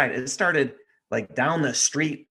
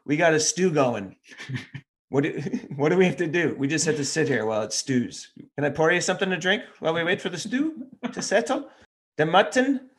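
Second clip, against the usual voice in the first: lightly put-on, higher-pitched Irish brogue; attempted Irish brogue; 6 semitones higher than usual. Irish host stalling guests while the stew cooks, rough Irish brogue. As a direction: have a go at an Irish brogue, lilting up high. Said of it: "I can't really do a brogue".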